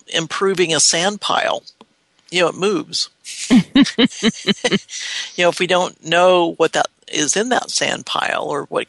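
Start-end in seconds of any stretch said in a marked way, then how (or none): 3.3-5.3 higher-pitched rippling laugh; long chuckle at your own analogy. Real, not acted. Laughing at sand pile image.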